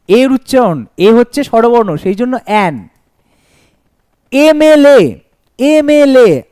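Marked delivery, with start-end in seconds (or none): 4.3-6.4 teacher sounding out MLA. Shown as a demonstration. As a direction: voice এমএলএ clearly so the এ comes first. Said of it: "আবার এ আসছে".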